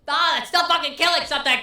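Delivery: high, loud mock-exasperated shout; comic answering gun owners, mock exasperated; exasperated outburst. Real, not acted. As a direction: throw up your hands at the hunting excuse.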